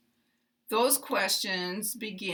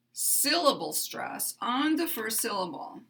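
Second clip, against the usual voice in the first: ordinary talk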